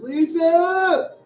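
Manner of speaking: high, loud call of "Lisa!". Retold or imitated. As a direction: yell her name in sudden panic. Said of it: "my first thought was".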